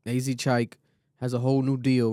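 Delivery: ordinary talk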